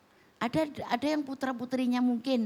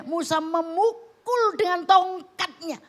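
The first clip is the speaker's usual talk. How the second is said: dramatic emphasis; 9 semitones higher, 7 dB louder, slower than usual. Acted. Dramatic climax of Moses story.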